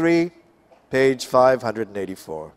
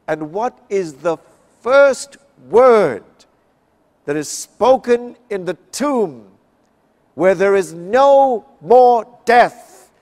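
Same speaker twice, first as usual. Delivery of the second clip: louder, higher, drawn-out preacher's build; preaching at full force; 7 semitones higher, 8 dB louder than usual. Real, not acted. Preacher to congregation at the empty tomb, rising loud. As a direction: lift the congregation toward the big question.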